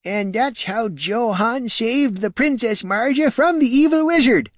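put-on high, eager old country farmer; a rustic old man spinning a hero tale. Old farmer boasting to the warrior, high and eager.

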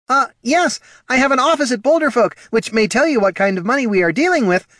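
high, fast, loud nervous patter; nervous gnome voice. Acted. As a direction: bluff through the fear. Poldo nervous boasting.

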